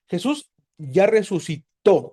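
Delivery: "resucitó" drawn out slowly, final "tó" stressed; accent the last O in "resucitó". Shown as a demonstration. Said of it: "con acento en la O".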